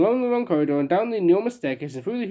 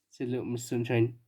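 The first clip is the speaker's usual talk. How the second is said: ordinary delivery, nothing marked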